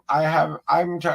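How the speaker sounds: usual speaking voice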